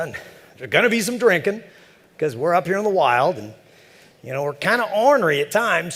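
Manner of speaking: high folksy voice building up to a sly punchline; sly old neighbor. Retold or imitated. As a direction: savour each item like a grinning old-timer. Neighbor lists party activities.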